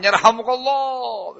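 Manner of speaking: high, drawn-out mock crowd chant; menirukan seruan menggema. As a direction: stretch it out like an echoing crowd. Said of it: "Begitu, menggema". (menirukan seruan bergema yang panjang)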